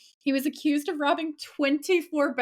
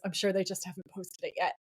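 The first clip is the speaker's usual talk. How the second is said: low, softer muttered retort; muttering; 4 semitones lower, 6 dB quieter, faster than usual. Retold or imitated. Sam answering Dean's teasing, muttered. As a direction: mutter it under your breath. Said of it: "Sam kinda mutters".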